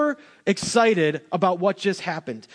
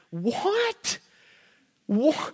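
very high, baffled "what?"; mock disbelief; 8 semitones higher, 3 dB quieter than usual. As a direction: squeak it out, then falter. Stunned at Jonah's angry reaction.

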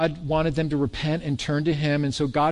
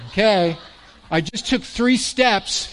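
slow, mocking "I just took three steps"; sarcastic retort; 8 semitones higher than usual. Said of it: "Looking at the guy going".

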